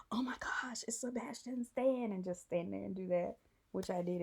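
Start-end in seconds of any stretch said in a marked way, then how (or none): 0.1-2.1 breathless starstruck whisper; starstruck fangirl. Shown as a demonstration. Posing as a dazzled fan in the photo, whispered. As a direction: gasp it out like a stunned fan. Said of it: "did something a fangirl would do".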